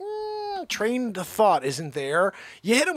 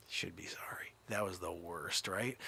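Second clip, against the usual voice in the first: ordinary talk, nothing marked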